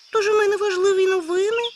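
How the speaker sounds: high, loud, astonished hare; a hare amazed that nobody knows; surprised hare. Acted. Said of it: "відповів здивований заєць". (здивований заєць, високо й гучно)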